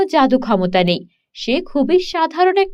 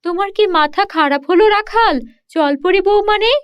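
higher, surprised lady of the house; landlord's wife; 6 semitones higher than usual. Acted. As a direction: demand to know where he is going. (উঁচু গলায় অবাক জমিদার গিন্নি)

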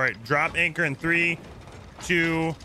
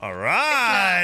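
excited cheer; 5 semitones higher, 4 dB louder than usual. Real, not acted. Celebrates successful negotiation.

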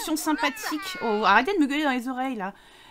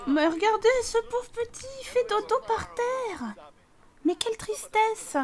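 mock pity; 9 semitones higher than usual. Pitié mimée pour le bébé.

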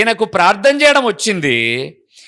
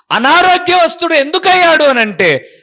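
ordinary talk

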